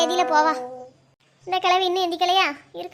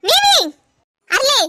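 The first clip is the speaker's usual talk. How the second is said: high, loud furious shout of the name; mother screaming her daughter's name in anger; angry yell; 6 semitones higher, 6 dB louder, much slower than usual. Acted. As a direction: bellow at the child.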